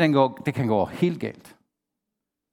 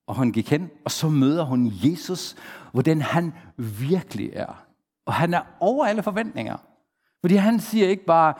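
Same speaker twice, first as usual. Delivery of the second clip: normal talking voice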